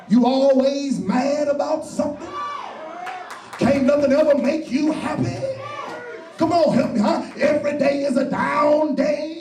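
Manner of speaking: preacher rousing the congregation; preaching at full force. Acted. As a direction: ramp up the fire. Preacher raises to a shout.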